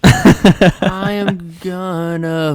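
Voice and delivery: drawn-out, loud mock retching; fake throwing up. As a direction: stretch it out as if about to throw up. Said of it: "Jordan fake throws up once a show".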